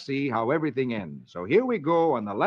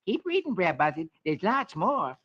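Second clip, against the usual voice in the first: cheerful; 6 semitones higher than usual. Acted. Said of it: "smiles cheerfully and says".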